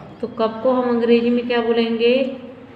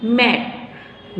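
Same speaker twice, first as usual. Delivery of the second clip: put-on drawn-out मैट for the children; slow model word; much slower than usual. Shown as a demonstration. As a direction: stretch मैट out very slowly so every sound is clear. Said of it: "क्या बोलेंगे".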